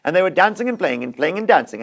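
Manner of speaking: plain reading voice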